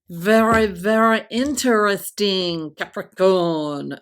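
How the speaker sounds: loud, badly faked French accent; terrible French accent. Tarot reader teasing Capricorn in a bad French accent. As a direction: put on a hammy French accent. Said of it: "that's my terrible French accent".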